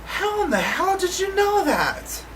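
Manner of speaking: high, slow, awed question; the friend, stunned after the crash, in awe; stunned disbelief. Retold or imitated. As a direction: ask it as if still in shock. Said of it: "she looks at me and is like".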